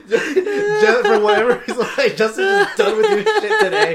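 high, loud, laughter breaking through; can barely talk for laughing; laughing hard. Real, not acted. Friend says Justin is fed up, laughing hard.

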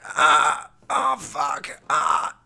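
retold cry of pain, pitch jumping up; a hit kid's yelp of pain; pained groan. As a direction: groan out like you just got knocked over. Kid groans after being hit.

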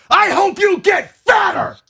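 high loud slow shout; yelling from car. Retold or imitated. Driving past the donut line, high loud shout. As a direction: bellow it at them while driving past. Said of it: "I drove by and I'm like".

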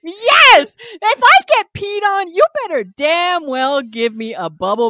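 loud, high-pitched insistence; comically vehement demand; emphatic outburst. Real, not acted. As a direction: burst out with a loud, high yes. Emphatic comic outburst about aftercare.

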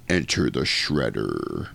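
low, slow movie-trailer announcer; dramatic announcer. Retold or imitated. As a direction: announce the villain's entrance gravely. Reading his dramatic note.

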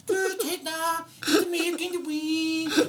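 high, drawn-out silly babble; babbling mock voice. Mocking own silly babble at the animal. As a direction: babble like a fool at the pet.

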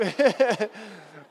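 warm amused laugh from the pulpit; real laugh. Real, not acted. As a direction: laugh along with the crowd. Laughs at scaring Emily.

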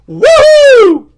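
high whooping cheer; mock cheer. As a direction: shout a goofy woo.

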